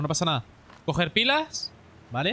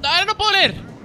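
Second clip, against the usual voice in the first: high, loud frustrated yell; frustrated shout; 11 semitones higher, 6 dB louder than usual. Real, not acted. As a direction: shout it out, annoyed. Grito durante el juego. (grito frustrado)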